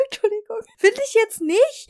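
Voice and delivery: trying to stop laughing; stifled giggles. Real, not acted. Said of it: "hör auf zu lachen".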